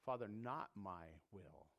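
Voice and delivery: hushed submission. Retold or imitated. Gethsemane submission prayer.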